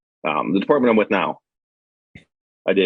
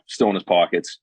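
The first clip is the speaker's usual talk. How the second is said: usual speaking voice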